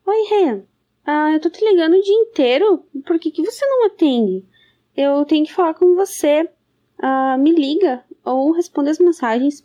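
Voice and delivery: loud. Acted. Frantic voicemail, calls ignored.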